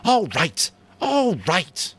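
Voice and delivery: high, loud angry outburst; shout. A narrator's reading. Jack shouting at Ralph, high and loud. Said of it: "His words came out in a shout".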